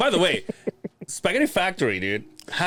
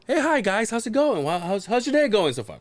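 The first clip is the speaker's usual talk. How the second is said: cheery waitress greeting; 8 semitones higher than usual. Retold or imitated. Waitress greeting voiced in story.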